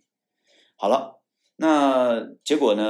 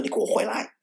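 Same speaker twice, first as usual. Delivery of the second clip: high, fierce call to come back; fierce order; 13 semitones higher than usual. Retold or imitated. Commander calling the officer back, fierce mock order. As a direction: bark the order like an angry boss. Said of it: "很凶的跟我说".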